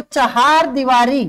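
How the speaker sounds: चहार दीवारी said slowly with a clear ह; चहार दीवारी, slow and deliberate; correct pronunciation. Shown as a demonstration. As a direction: carefully sound चहार with the ह, not चार. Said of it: "वो होती है चहार दीवारी".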